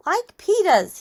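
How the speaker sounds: put-on high, loud toddler voice; excited little kid. A narrator's reading. Fudge wanting his brother's shoes, high childish voice. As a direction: pipe up high like a small child.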